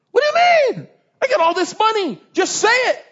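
high, loud indignant outburst; outraged disbelief. Acted. King yelling at the prophet who refused him, outraged. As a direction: yell it in exasperated protest.

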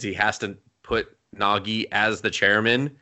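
usual speaking voice

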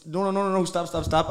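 retold flustered pleading, climbing in pitch and volume; panicked mock protest. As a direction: act out frantically calling a halt. Retold protest during massage.

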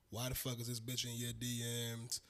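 ordinary talk

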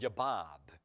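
low, confirming, imitated Massachusetts accent. Local man confirms his name to the preacher, Massachusetts accent. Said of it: "they do have a different accent".

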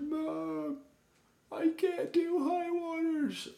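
put-on a kid wailing that he can't do it; boy wailing to his mom about high waters, mock sobbing; whiny mock crying.

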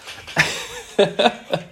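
high airy giggle that breaks out mid-story; can't hold back a high laugh; breathy laugh. Real, not acted. Laughing at his mate's fantasy blunder, high airy giggle.

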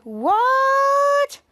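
high, loud, stretched "what"; Henry stunned to see his own picture; shocked. Retold or imitated. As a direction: blurt it out stunned.

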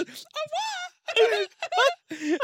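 extremely high, stretched-out French goodbye; mock Frenchwoman's squeaky farewell; imitated French farewell. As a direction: coo it very slowly in falsetto. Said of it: "Like that. She goes".

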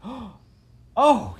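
high, loud delighted oh; gasp, then a big thrilled exclamation; joy and amazement. A narrator's reading. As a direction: burst out amazed and overjoyed. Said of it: "in tones of joy and amazement".

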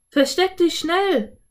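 high, loud, drawn-out warning; urgent call. A narrator's reading. Boy calls to his sister as riders approach, urgent. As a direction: call out slowly and high in alarm. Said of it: "rief Philipp".

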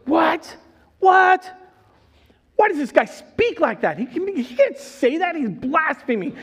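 high, loud indignant cry of What; outraged scribes. Acted. Preacher voicing scribes shocked by Jesus forgiving sins, loud outrage. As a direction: shout it like scandalized scribes.

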